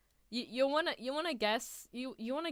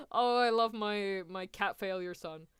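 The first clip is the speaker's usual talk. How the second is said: ordinary talk, nothing marked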